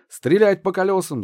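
high, loud shouted order; shouting. A narrator's reading. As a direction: yell the order across the field. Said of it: "закричал офицер".

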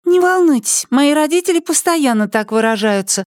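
very high, put-on child voice; high-pitched kid character; little girl's voice. Little girl Molly reassures the adult.

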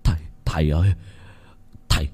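dying man begging the spirit to spare him; stammering plea. Acted. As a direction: stutter out a terrified plea for mercy. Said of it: "miệng lắp bắp".

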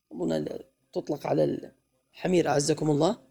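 ordinary delivery

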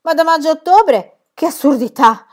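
acuta e forte, incredula; scandalizzata. Acted. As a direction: grida l'assurdità con stupore finto. Pippi scandalizzata, voce acuta. (Pippi finge scandalo, alta e forte)

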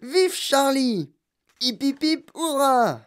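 high, loud, drawn-out cheers; jubilant cheering. A narrator's reading. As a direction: lead a triumphant hurrah. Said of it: "cria-t-il".

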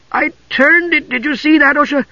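high-pitched kender astonishment; amazed. A narrator's reading. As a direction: marvel at your own feat, pitched high. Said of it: "Tass sounded slightly amazed".

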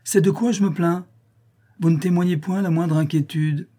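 acted lover's-quarrel exasperation; indignant reproach. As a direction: complain at the lover's lack of concern. Countess reproaches her calm lover.

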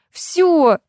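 high-pitched outburst; sharp, high one-word cry; yell. A narrator's reading. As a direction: yell it in exasperation. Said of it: "заорала я".

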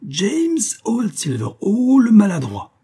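high, slow, sneering madman's taunt; odious mocking voice. A narrator's reading. The masked madman jeering at clumsy James, high and mocking. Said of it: "Le rire odieux de Big Johnson".

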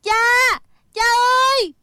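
anguished high call. Acted. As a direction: call out to a father in distress. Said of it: "Thích Phương cũng gọi".

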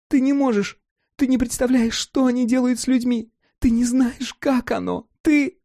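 put-on high, piercing childlike cry; sharp scream. A narrator's reading. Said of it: "Давид закричала. Сильно и резко".

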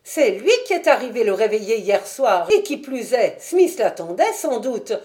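a chatty wife describing a strange visitor; Mrs Smith. Acted. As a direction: spill the gossip eagerly. Landlady voiced by narrator.

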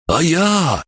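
high, stretched-out cry; calling after. A narrator's reading. Abandoned captive calls after his captors, crying out. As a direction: call out plaintively to people walking off. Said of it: "крикнул он нам вслед".

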